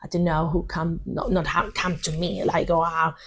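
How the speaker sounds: ordinary talk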